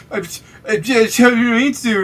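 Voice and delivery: high, slow stammering plea; scared stammer. Acted. Frightened customer stammers an apology to the waffle seller, high and halting. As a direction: stutter it out like a frightened man caught cutting line.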